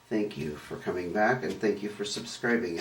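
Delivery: ordinary talk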